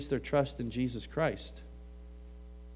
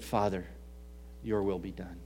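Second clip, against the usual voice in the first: quiet surrender; 8 semitones lower, 9 dB quieter than usual. Acted. Jesus surrenders in prayer.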